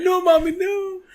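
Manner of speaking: put-on high whiny plea of a scolded kid; pleading child. Spy son begging his mom, high childish plea. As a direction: beg mommy like a little boy.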